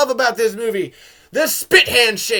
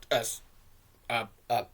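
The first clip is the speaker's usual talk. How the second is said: ordinary talk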